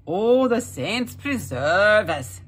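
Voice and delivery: slow, loud whine of a lazy husband; whining. A narrator's reading. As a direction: moan it out slowly, self-pitying. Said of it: "Jamie would whine".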